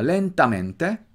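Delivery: LENTAMENTE drawn out slowly with marked stress, rising at the end; well-marked accent. Shown as a demonstration. Teacher shows the accent of "Lentamente", slow and well marked. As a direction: stretch "Lentamente" and mark its accent, ending upward. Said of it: "Nota el acento bien marcado".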